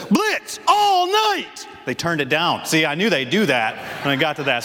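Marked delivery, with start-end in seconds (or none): 0.1-1.6 big shout. Shown as a demonstration. Preacher acting the coach's huddle line, full shout. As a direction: let it rip like a fired-up coach. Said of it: "they don't want the sound system to, like, blow up".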